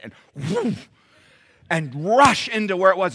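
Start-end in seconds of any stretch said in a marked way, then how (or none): 0.5-0.9 breathy rushing whoosh noise; imitated whoosh. Preacher imitates dog racing to the bug, whooshing noise. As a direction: make the sound of a dog zooming off.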